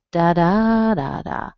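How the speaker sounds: "da, da, da, da" as da-DAA-da-da; second-syllable stress. Shown as a demonstration. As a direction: raise and stretch the second beat, let the rest fall away. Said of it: "It is a pattern that is".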